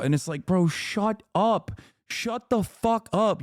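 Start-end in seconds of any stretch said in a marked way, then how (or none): none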